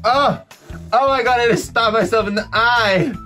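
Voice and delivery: sudden high pained outcry; startled yelp. Real, not acted. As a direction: yelp from a poke in the eye. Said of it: "That's so sore".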